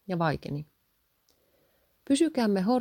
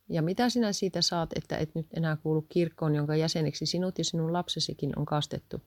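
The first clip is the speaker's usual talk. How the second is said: plain reading voice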